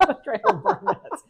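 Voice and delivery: high, loud words through laughter; real laughter breaking through; laughing. Real, not acted. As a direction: crack up while repeating the line. Laughing repeat of joke.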